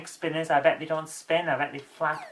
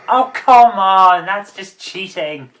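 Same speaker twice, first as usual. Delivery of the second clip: loud, slow exasperated groan; frustrated; 2 semitones higher, 11 dB louder, slower than usual. Real, not acted. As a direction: let it out at the unfair game. Frustrated at the game.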